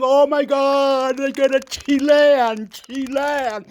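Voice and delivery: high, loud, slow overjoyed cry; excited crying. Retold or imitated. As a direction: squeal it like you just found your favourite show. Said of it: "I would've been crying".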